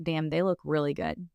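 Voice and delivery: ordinary talk, nothing marked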